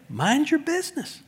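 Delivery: high, soft teasing snark; playful mock snark; snarky. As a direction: tease them with it. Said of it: "it comes off as snarky".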